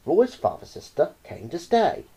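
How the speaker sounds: plain reading voice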